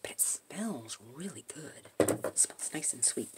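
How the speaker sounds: soft whispered aside; whispering. Real, not acted. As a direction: hush down to a whisper. Whispered aside about wax.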